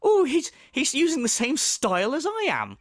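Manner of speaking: hammed-up petty objection; mock complaint. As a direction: complain as a silly accuser. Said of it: "That's like, uh, me going".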